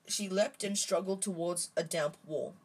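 plain reading voice

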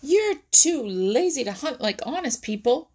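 high, loud jeer; storybook hawk's mocking jibe; taunting. A narrator's reading. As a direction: taunt your rival as the hawk. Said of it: "taunted old Whitetail".

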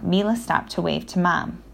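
ordinary delivery, nothing marked

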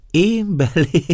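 high, loud, laughing question. Acted. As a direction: burst out amused and incredulous. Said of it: "szólt végre nevetve Éva".

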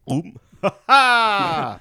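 high, loud gloating "Ha ha"; gloating villain laugh. Retold or imitated. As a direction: crow over the win like a smug villain. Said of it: "He's like".

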